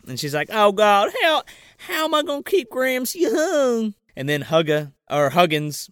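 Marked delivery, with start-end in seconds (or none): 0.5-3.9 higher, whiny put-on lament; hammed-up tearful whine; mock crying. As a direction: wail it in pretend despair. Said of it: "she cries a river".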